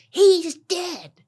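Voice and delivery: very high, loud outburst; imitated yell. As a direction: scream it out, mimicking him. Man's answer retold as a yell.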